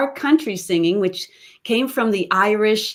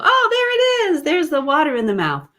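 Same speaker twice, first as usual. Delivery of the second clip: mock exclamation; 8 semitones higher than usual.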